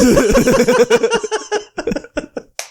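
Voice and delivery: high burst of laughter; big laugh. Real, not acted. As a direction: crack up loudly. Cracks up at the punchline.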